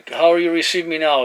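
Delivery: usual speaking voice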